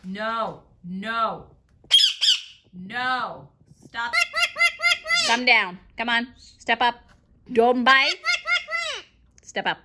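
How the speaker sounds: loud sharp commands; loud scolding. Real, not acted. Owner scolding Milo for biting, loud shouted commands. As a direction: shout the bird off, firm.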